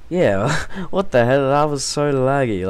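loud, high, laughing disbelief; laughing outburst. Real, not acted. Gamer laughing at a laggy opponent, loud disbelief. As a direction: laugh it out at the lag.

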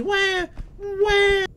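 very high, loud fake "wah"; mock baby wail. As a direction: bawl like a hammed-up newborn. Pretending to be a crying baby.

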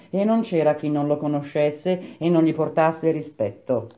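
usual speaking voice

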